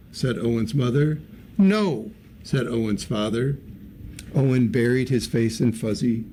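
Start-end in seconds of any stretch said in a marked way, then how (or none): 1.6-2.1 firm no. A narrator's reading. Father refuses firmly.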